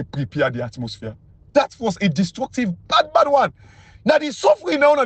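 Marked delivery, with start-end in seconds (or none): 2.9-5.0 high lament. Real, not acted. Lamenting, pitch jumps high.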